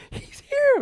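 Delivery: suddenly loud, very high-pitched squeal; starstruck squeal. Retold or imitated. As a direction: burst out in a thrilled high squeak. Starstruck husband repeats his alert.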